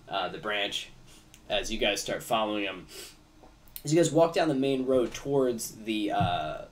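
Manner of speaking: usual speaking voice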